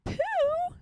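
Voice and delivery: high alarmed squeak; alarmed cry. A narrator's reading. Piglet cries out to Pooh, high and alarmed. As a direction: cry out in fright. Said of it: "cried Piglet".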